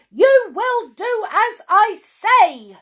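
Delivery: high, loud and slow demand; angry shout. Acted. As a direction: bark the order at them. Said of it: "shouted Miss Bossy".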